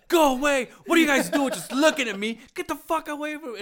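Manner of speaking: high, loud retold shout; yelling kids away. Caught squatting, shouting at the boss's kids, mock panicked yell. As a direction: shoo them off, loud and high-pitched.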